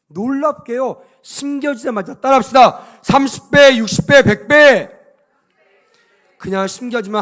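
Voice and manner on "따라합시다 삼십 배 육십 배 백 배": loud, high chant for the congregation to repeat; rallying call. Real, not acted. Preacher leading congregation to repeat the multiples, shouted call. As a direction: call out each number with full force.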